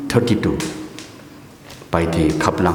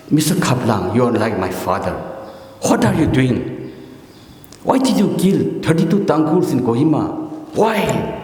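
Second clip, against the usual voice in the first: building from an earnest appeal to a much higher indignant cry; retold scolding; 5 semitones higher than usual. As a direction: scold him like a daughter confronting her father, rising to a high "Why?". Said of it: "She chastised him on his face".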